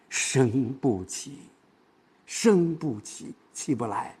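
升不起 said slow and soft, pressed inward; light stress. Shown as a demonstration. Teacher showing a stressed word read lightly, slow and soft. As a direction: stress 升不起 by slowing and softening it. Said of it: "升不起虽然是重点，但是我轻读".

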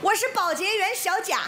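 ordinary delivery

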